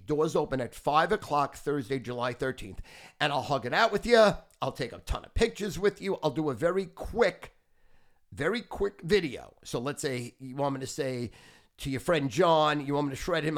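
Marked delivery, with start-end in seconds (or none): none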